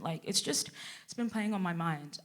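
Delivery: soft, low, tentative; imitating an awkward coworker; hesitant colleague. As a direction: tiptoe quietly toward the question. Colleague hesitantly leads to question.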